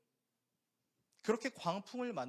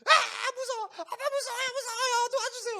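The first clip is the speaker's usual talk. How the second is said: put-on much higher and louder, a panicked child's wail; terrified child crying out; 11 dB louder than usual. Pastor imitating his son screaming at the sheep, high panicked wail. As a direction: cry for help like a scared little boy. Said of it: "소리를 지르기 시작했어요".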